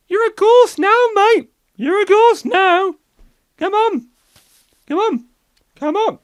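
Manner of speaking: high, loud insistent chairman; chairman ghost. Pretend. Chairman urging the new ghost, high insistent voice. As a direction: chivvy him along, high and firm.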